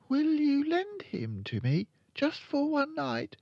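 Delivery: put-on high little-girl voice; high girl character; admiring Matilda. A narrator's reading. Matilda begs to borrow bird.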